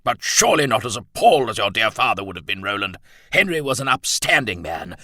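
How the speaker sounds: blustering colonel, loud then grave; Bennett's voice. A narrator's reading. Colonel shaming Roland by his father's example, indignant then grave.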